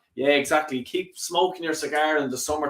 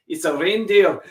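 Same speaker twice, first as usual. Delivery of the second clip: attempted Scottish accent; 3 semitones higher, 4 dB louder than usual. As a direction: joke punchline in a put-on Scottish accent. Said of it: "me trying to do the Scottish accent there".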